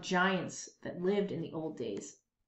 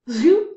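louder rising zoop; 4 semitones higher, 12 dB louder than usual. A narrator's reading. Narrator sounds the wand taking a chair. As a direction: zap the chair away.

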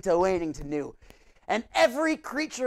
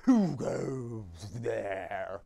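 very low, very slow clam voice; 12 semitones lower, 5 dB quieter, much slower than usual. Acted. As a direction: drag it out deep and slow like a clam. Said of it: "That's my clam voice".

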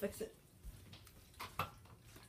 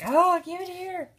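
very high, loud, slow cat squeal; mock demand from the excited cat; greedy cat voice; 14 semitones higher, 10 dB louder, slower than usual. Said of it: "Patches is like".

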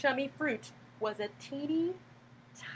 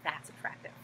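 sarcastic whisper; 7 semitones lower, 10 dB quieter, faster than usual. Real, not acted. Sarcastic aside after burp.

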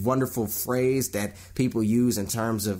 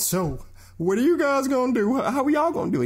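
much higher, louder, eager question; mock eager interviewer; 4 dB louder, faster than usual. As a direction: push the question like a keen reporter. Interviewer asking, voiced high.

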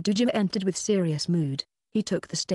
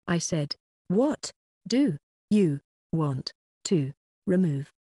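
slow, drawn-out, spelled-out repeat; slower than usual. Acted. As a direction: say it slowly for someone hard of hearing. Repeats slowly to deaf lady.

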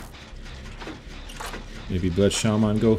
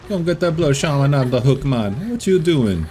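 put-on higher, louder Jamaican accent calling for help; mock Jamaican accent; 6 semitones higher, 5 dB louder than usual. Hooked player calls teammates for rescue in a higher, louder mock Jamaican accent. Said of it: "I don't know why I talk like a Jamaican".

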